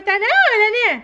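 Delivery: put-on high toddler voice; baby doll asking if it's time. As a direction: say it like an eager little girl. Same words, mom after.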